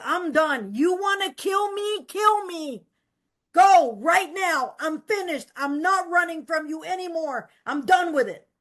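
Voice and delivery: daring God to finish it; defiant challenge. Retold or imitated.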